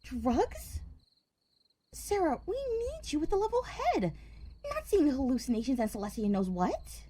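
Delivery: shocked objection. Acted. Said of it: "Flora objected in shock".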